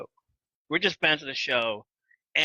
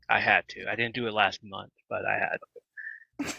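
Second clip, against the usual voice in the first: normal talking voice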